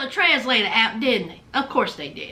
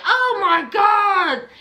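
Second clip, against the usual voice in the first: exasperated groan; 2 semitones higher, much slower than usual. Retold or imitated. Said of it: "She was like".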